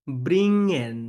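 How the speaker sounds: ব্রিংয়েন said clearly, each syllable spelled out; teacher models ব্রিংয়েন for students; model German word. Shown as a demonstration. German teacher modelling a word for students, slow and clear. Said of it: "ব্রিংয়েন মানে হচ্ছে কোনো কিছু নিয়ে আসা".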